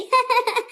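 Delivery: high, short teasing laugh; mocking laugh. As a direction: laugh at someone's lisp. Laughing at the bride's lisp. (मज़ाक उड़ाती हँसी)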